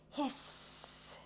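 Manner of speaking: soft breathy little hiss; high soft owlet hiss; baby owl hiss. A narrator's reading. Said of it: "hissed the baby owls".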